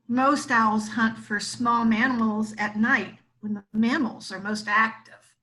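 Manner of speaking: ordinary delivery, nothing marked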